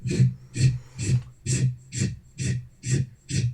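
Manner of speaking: steady run of short shushes; imitated dragging noise. Showing how feet dragged on the carpet sounded, rhythmic shushing. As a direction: mimic feet scraping over carpet, shh by shh. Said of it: "Like if someone is just, like, dragging".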